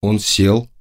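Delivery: plain reading voice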